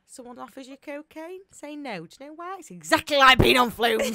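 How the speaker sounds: high-pitched assembly presenter; mock school talk. Speaker warning schoolchildren off cocaine, mock instructive. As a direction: pretend to lecture children, building to a loud punchline. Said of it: "we should go into schools with that".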